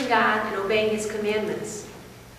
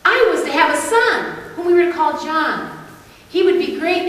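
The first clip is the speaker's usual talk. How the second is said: higher, louder, excited announcement; amazed joy; 6 semitones higher, 6 dB louder than usual. Acted. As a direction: share astonishing news with a burst of joy. Character relates astonishing good news.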